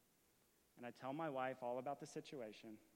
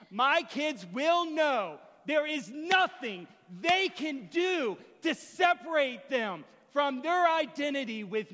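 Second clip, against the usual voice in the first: slow, high, shouted declaration; full-force preacher, hammering each phrase; impassioned preaching; 10 semitones higher, 17 dB louder, slower than usual. Real, not acted. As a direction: thunder it out to the congregation.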